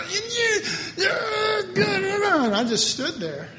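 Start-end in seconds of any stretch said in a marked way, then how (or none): 0.0-2.5 high, loud mock ranting noises; imitated tirade. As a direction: mimic someone shrieking a scolding, drawn out.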